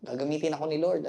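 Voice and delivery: quietly pleased with himself, mock-proud; smug inner voice.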